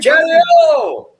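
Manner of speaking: very high, loud sung-out hello; cheery greeting. Real, not acted. Man greeting a friend who joined, high and loud. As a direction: sing out the greeting.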